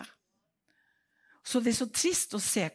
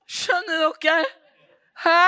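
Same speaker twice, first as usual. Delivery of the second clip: loud, higher, laughing cry; laughing in disbelief; 8 semitones higher, 11 dB louder, slower than usual. Real, not acted. Preacher to congregation, laughing loud and high. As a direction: laugh it out in shock.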